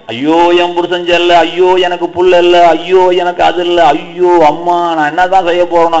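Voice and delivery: slow, droning mock wail; mock lament. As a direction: act out a string of drawn-out woes. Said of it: "அகத்துல தான் வேதனை வச்சுக்கிட்டு இருக்கிறிய".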